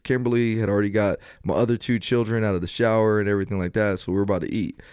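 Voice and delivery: normal talking voice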